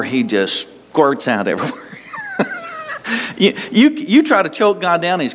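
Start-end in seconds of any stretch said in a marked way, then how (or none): 1.8-3.3 short high-pitched giggle; amused little squeal; high squeaky laugh. Real, not acted. Laughs at own image.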